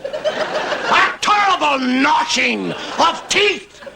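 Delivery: very high, slow shouted damnation; Paisley preaching. Retold or imitated. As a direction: roar out the eternal flame. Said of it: "and he talks like that".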